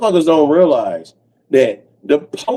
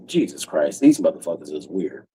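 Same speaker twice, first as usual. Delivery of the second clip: low, quieter disbelieving mutter; muttering agent; 9 semitones lower, 8 dB quieter than usual. Retold or imitated. Agents reviewing his stream, low quieter mutter. Secret Service listening to video.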